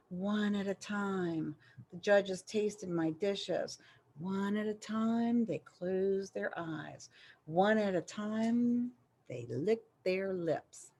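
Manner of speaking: slow, hushed suspense narration. A narrator's reading. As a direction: draw it out quietly like a held breath. Tense judging scene, hushed.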